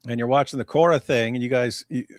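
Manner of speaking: ordinary delivery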